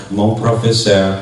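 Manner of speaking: usual speaking voice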